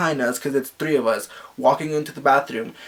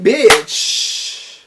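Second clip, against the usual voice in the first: sassy dismissal; 6 semitones higher, 5 dB louder than usual. Retold or imitated. Dismissive retort to stalker.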